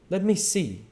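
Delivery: a high, sharp suspicious demand. Acted. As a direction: demand to see, on guard. Invisible Man, suspicious.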